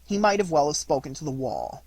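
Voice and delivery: usual speaking voice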